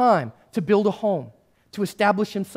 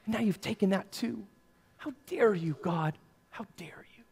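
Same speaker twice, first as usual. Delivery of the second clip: softer and softer, ending in a whisper; bitter whisper; 10 dB quieter than usual. Retold or imitated. Joseph accusing God, fading to a bitter whisper. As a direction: hiss it at God, spent.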